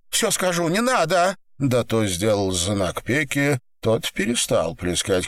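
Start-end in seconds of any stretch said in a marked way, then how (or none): 0.1-1.4 high, urgent plea; desperate pleading. Acted. Woman promises captor a confession, high pleading. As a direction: give in, begging in a high voice.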